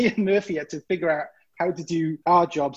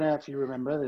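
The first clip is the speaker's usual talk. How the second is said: ordinary delivery, nothing marked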